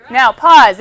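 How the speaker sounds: loud call of Now, pause. Real, not acted. Teacher to class, calling a halt, loud. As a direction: stop the room with it. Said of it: "calls the class to stop".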